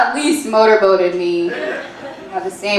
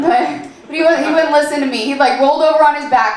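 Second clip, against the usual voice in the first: ordinary delivery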